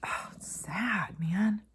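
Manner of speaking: whispered, slow, heavy-hearted; sad whisper. Real, not acted. Vlogger moved by a grieving girl's video, sad whisper. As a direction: breathe it out as a pained whisper.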